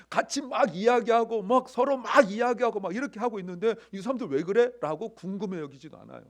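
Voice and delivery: impassioned preaching. Real, not acted. Preacher urging congregation to feel the women's excitement, impassioned.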